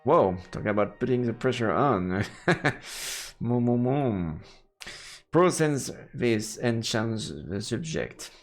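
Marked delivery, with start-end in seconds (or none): none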